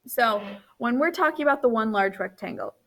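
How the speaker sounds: normal talking voice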